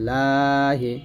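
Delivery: ordinary talk, nothing marked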